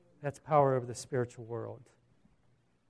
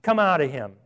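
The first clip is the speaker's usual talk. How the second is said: firm, louder order; stern command; 6 dB louder than usual. Acted. Jesus ordering the demon out of the man, sternly. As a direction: order it out with authority. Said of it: "Jesus said sternly".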